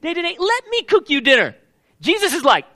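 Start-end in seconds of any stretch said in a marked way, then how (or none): 0.0-1.6 much higher, sugary put-on master voice; hammed-up sweet master voice, high pitched; mock doting master. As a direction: play the master fawning over his servant. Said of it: "do you think the master's gonna say".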